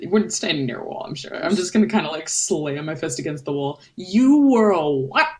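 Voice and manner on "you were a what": high, loud incredulous outburst; acted indignant yell; outraged disbelief. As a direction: explode on the question, higher and louder. Character slams wall, confronts friend.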